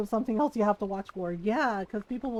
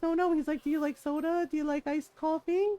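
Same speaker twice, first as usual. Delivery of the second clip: eager insisting dad; 7 semitones higher, 3 dB louder than usual. Retold or imitated.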